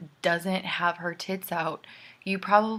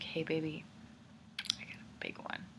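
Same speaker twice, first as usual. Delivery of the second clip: mock seduction, soft and whispery at the end; serious come-on; 2 semitones lower, 13 dB quieter than usual. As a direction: imitate a guy earnestly hitting on someone, hushed. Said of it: "It's not, like, really like".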